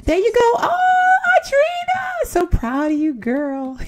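high, drawn-out gushing cheer; gushing praise. Real, not acted. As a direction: squeal with pride at her. Cheering on her Sims character.

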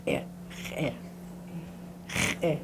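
"Eh" clipped short with a catch; short stopped "Eh"; glottal stop. Shown as a demonstration. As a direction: repeat the glottal "Eh". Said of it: "it has that glottal stop".

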